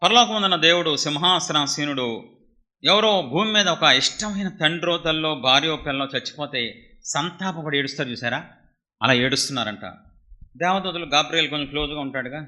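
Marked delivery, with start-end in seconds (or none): none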